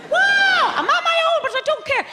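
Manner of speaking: high, whooping mock-American bravado; brash American confidence. Comedian mimics an American in the audience, brash mock confidence. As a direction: holler it high like an overconfident American. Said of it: "like, American-style confidence".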